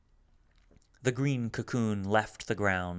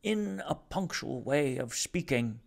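glottal tenor; 4 semitones higher than usual. Acted. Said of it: "Rumford's glottal tenor".

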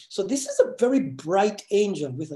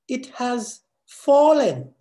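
slow, loud and higher declaration; proclaimed; 6 semitones higher, 5 dB louder, much slower than usual. Acted. Preacher declaring the fall of Babylon to the congregation, slow and loud. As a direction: ring it out like a verdict.

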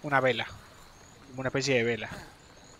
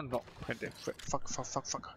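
very soft frustrated swearing under breath; muttered frustration; 3 semitones lower, 15 dB quieter, much slower than usual. Real, not acted. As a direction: curse quietly to himself. Can't bandage in hand. (maldiciendo muy bajito, frustrado)

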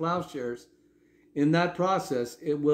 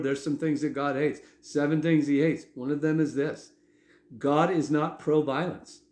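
usual speaking voice

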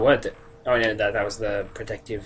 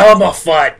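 mock shout; 5 semitones higher, 13 dB louder than usual. Joke name blending Elmer Fudd.

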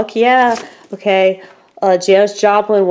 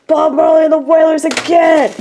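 excited shout; 8 semitones higher than usual. Real, not acted. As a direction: shout it out in delight. Finds another [name] CD.

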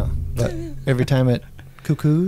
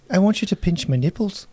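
higher-pitched mock Irish lilt; Irish accent; 6 semitones higher than usual. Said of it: "No, that's Irish".

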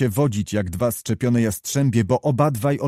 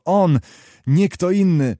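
higher, louder glad exclamation; keen, emphatic agreement; excited confirmation; 6 semitones higher, 4 dB louder than usual. A narrator's reading. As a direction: confirm it with delighted certainty. Delighted confirmation of friend's arrival.

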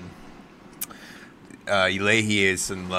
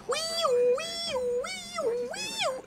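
mock siren wail; 6 dB quieter, much slower than usual. Imitating ambulance siren.

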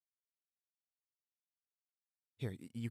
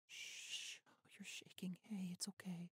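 whisper; 8 semitones higher, 12 dB quieter, slower than usual. Acted. Comforting shaken girlfriend.

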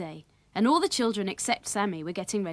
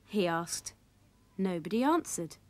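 plain reading voice

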